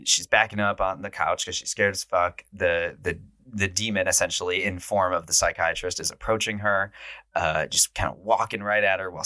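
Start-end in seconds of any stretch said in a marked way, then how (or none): none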